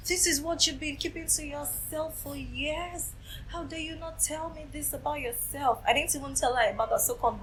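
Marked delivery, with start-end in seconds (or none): 0.1-5.8 imitated wounded reproach, high and soft; shocked mother. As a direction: play the stunned mother, high-pitched and hushed. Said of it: "She was like".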